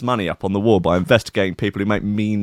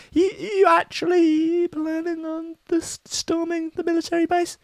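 mock FBI agent; 3 dB quieter than usual. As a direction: squeak it like a silly agent. FBI agent asking, voiced.

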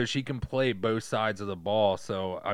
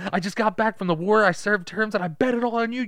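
high, indignant mock outburst; acted angry rant; 7 semitones higher, 5 dB louder than usual. War veteran who bet it all on a player, mock fury. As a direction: blame the player with hammed-up outrage.

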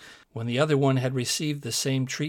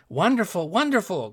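higher, excited exclamation; admiring cry; 6 semitones higher than usual. A narrator's reading. Court admiring the little tailor, crying out excitedly. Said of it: "cried everyone".

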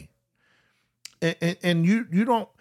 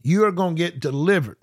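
ordinary delivery

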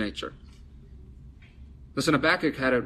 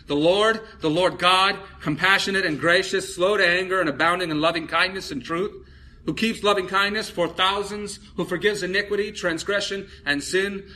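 higher, emphatic scripture proclamation; solemn proclamation; 6 semitones higher, 4 dB louder than usual. Retold or imitated. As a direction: proclaim each divine attribute with rising force. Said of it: "passed in front of him and proclaimed".

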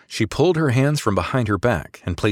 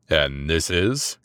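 low, slow growl; slow, lower menacing growl; 4 semitones lower, much slower than usual. Acted. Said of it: "Gallo growled".

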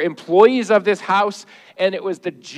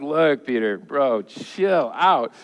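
laid-back calming; 6 semitones lower, much slower than usual. Acted. Jesus calming Peter, comic.

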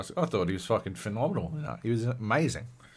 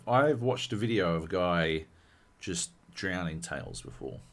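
ordinary talk

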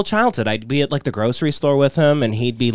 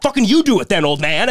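higher, louder imitation of the fed-up bag boy; mock angry bag boy; 6 semitones higher, 4 dB louder than usual. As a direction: fling it back at the old man. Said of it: "they'd be like".

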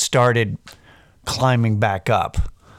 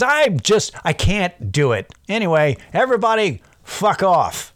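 higher, self-mocking collapse of a pep talk; mock falling apart; 6 semitones higher than usual. A pep talker mocking himself crumbling, high mock collapse. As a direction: give up with a squeaky mock fluster. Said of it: "and just goes".

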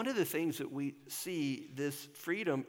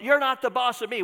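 put-on high, loud bratty kid voice; mock childish protest; 10 semitones higher, 12 dB louder than usual. Pastor mocking a child's protest, loud and bratty. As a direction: blurt it like a stubborn child. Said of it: "kind of an infantile, childish thing to say".

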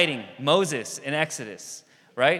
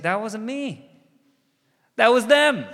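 higher, louder mock protest, hands up; protesting Moses; 9 semitones higher, 5 dB louder than usual. Moses protests to God, a mild mock yell. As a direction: insist it was them, rising to a yell.

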